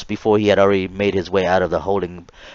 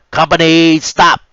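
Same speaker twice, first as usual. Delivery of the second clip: loud, high furious scream; screaming head RDC; 9 semitones higher, 7 dB louder, slower than usual. Retold or imitated. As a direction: scream at the sloppy marchers. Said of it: "screamed the head R D C".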